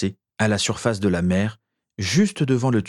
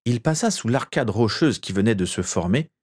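plain reading voice

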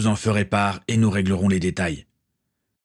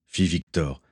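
ordinary delivery

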